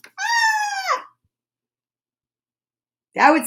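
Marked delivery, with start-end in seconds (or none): 0.1-1.1 sudden scream, much higher; shrill scream. Acted. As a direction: yelp it out high. Monster scream.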